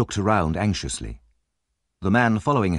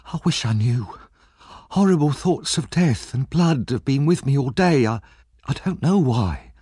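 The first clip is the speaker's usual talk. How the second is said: put-on higher, anxious young woman's voice; Nancy, tense and fearful, pitched up; frightened young Nancy; 7 semitones higher than usual. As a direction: voice the scared girl on edge. Nancy's voice held in reading.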